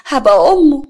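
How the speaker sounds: shocked outburst. Acted. Daughter confronts mother over fiancé.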